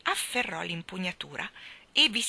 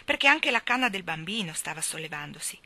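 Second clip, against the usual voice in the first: ordinary delivery, nothing marked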